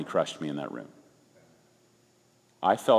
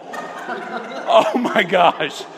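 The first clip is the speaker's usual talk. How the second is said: drawn-out laughing "oh my gosh"; slow, laughing exclamation; 13 semitones higher, 7 dB louder, much slower than usual. Real, not acted. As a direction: laugh through it in disbelief. Laughing recalling the stunt.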